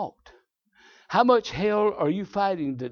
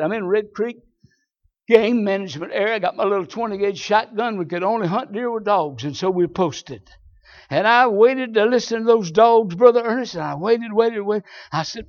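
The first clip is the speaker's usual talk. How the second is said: ordinary delivery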